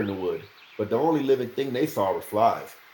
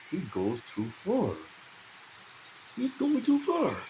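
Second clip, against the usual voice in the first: inner thought; 9 semitones higher, 6 dB quieter than usual. A narrator's reading. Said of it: "Dunk thought".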